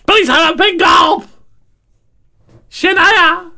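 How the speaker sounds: put-on high, slow yelled American accent; hammed-up American shout. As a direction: bellow the order across the diner. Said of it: "it's called".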